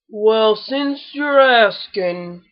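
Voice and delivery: very slow, rumbling gloomy donkey; slow rumble. A narrator's reading. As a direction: drag every word like Eeyore. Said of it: "rumbled Eeyore in his very slow way".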